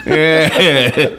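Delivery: laughing while talking; amused, breaking into laughter. Real, not acted. Laughing after getting cough drop.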